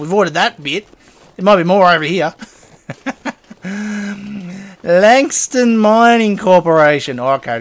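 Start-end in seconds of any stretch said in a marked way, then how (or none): 4.8-7.2 Langston Mining Corporation read out slowly and grandly, each word drawn out; slow sign reading. Real, not acted.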